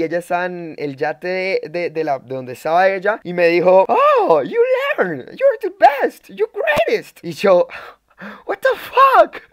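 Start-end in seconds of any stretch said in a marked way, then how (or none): 3.9-7.2 high squealed falsetto impression; mock gringa. Storyteller mimicking the gringa cheering him from the yacht, squealing falsetto. Acts out the American girl praising him. 8.5-9.4 loud falsetto shrieks of disbelief; mock amazement. Storyteller replaying his miracle swim, mock-amazed falsetto.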